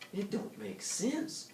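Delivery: high, soft, slow musing; to himself. Acted. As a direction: puzzle it out under his breath. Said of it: "more to himself than to Dora".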